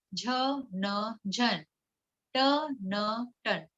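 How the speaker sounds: put-on slow, spelled-out syllables read clearly to children; patient teacher sounding out words; phonics drill. Shown as a demonstration. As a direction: sound out each letter then blend the word.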